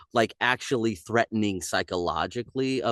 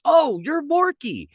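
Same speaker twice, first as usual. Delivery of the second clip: surprised recognition; 5 dB louder, slower than usual. Retold or imitated. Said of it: "we're like".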